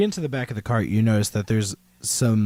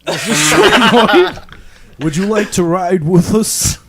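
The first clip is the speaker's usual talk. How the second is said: put-on a guard teasing the goblin child; Cockney guard; 6 semitones higher, 8 dB louder than usual. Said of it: "I can stay in Cockney".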